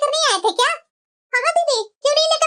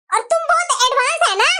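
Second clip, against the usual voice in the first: ordinary delivery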